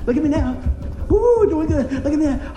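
high, loud whooping boast; acted look-at-me performance; excited showing off. Believer performing to earn God's love, excited showing off.

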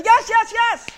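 high, loud, rapid-fire agreement; old lady eagerly insisting he pray; eager yes. Retold or imitated. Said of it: "She said".

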